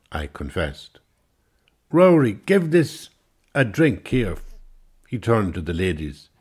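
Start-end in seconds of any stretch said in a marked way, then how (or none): 1.9-4.4 high, loud barroom command; loud bossy order. Acted. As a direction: call out to the barman like you own the place. Englishman orders barman.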